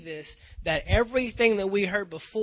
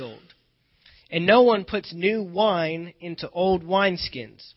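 loud, full-voiced Bible reading; booming scripture read from the pulpit; 9 dB louder than usual. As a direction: proclaim the verses to the congregation. Reads scripture aloud in full voice.

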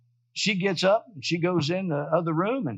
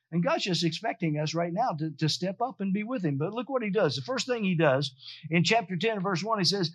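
ordinary delivery

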